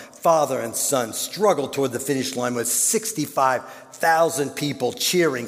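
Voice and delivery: ordinary talk, nothing marked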